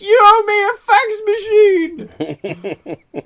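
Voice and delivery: very high, loud crying yell; hammed-up anguished wail; wailing shout. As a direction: howl it from the bottom of the stairs. Thrown down stairs, yelling back.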